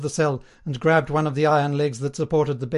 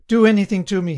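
higher, louder outburst; narrator's contained yell, pitched up; desperate yell; 5 semitones higher, 6 dB louder than usual. A narrator's reading. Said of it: "he yelled".

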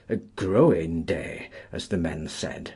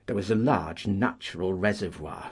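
usual speaking voice

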